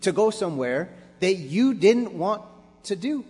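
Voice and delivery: preaching at full force. Acted. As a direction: preach it with fire and climb.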